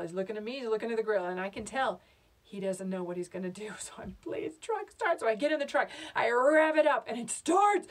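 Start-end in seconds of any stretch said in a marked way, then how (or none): 4.2-5.2 high, squeaked begging; high-pitched frantic plea; desperate pleading. Acted. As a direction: beg as if your life hangs on it. Begging the truck to start.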